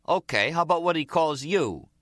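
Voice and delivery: loud, high-pitched retort; heated challenge. A narrator's reading. Nicky challenges Jimmy Cap over name-calling, heated retort. Nicky pushes back at his boss.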